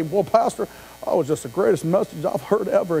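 butter up the pastor, sugary and hushed; mock flattery. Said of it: "see me at the door and say".

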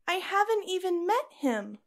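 high, dismayed protest to her mother; upset protest. Acted. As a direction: blurt it out, shocked she is betrothed to a stranger. Daughter protests arranged marriage.

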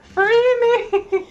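high loud squeaky cry of a captive; mock plea. Voicing a trapped follower begging to be freed, high mock plea.

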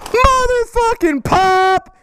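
high, slow drawn-out excitement; thrilled about the new pop; gleeful. Real, not acted. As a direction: savour it with excitement. Excited unboxing a Funko pop.

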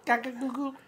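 put-on soft, high baby babble. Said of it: "Read the joke in a baby's voice".

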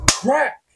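sudden high-pitched crack; imitated smack. Imitating her hit out of nowhere, sudden smack. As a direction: voice the blow as a sharp sound effect.

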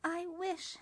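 a lightly voiced small cricket, sad and sulky; a hint of a gloomy young cricket; sulky little cricket. A narrator's reading. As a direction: pout your way through it as the little cricket.

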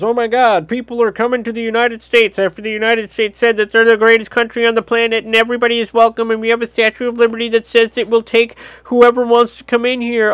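high, whiny mock alarm; exaggerated pearl-clutching whine; mock panicked outrage. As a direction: ham up a panicked outraged citizen. Mocking panicked anti-immigrant voice.

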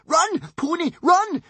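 high, loud squeal of panic; voiced Wish squealing in terror. A narrator's reading. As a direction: shriek it at the pony. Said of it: "squealed Wish".

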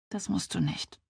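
soft, sighed reply; a small sigh through the words; sighing reassurance. A narrator's reading. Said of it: "seufzte sie". (leise seufzend)

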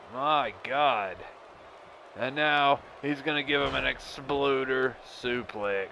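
drawn-out excited match call; excited play-by-play. Acted. Calls the match.

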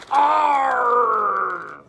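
growled pirate arr. Acted.

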